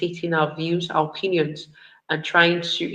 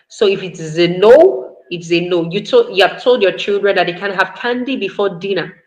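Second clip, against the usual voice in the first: ordinary talk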